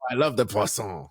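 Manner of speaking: hammed-up French accent; a hint of a mock French chef accent; foreign accent in french. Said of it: "the worst appropriation of French".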